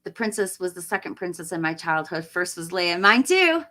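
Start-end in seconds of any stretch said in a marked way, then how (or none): 3.0-3.6 higher and louder, eager; a fan gushing in agreement; excited agreement. Real, not acted. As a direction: jump in with delight.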